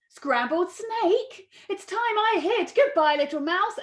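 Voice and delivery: high startled voice; alarmed snake. Acted. Snake to mouse before fleeing, alarmed.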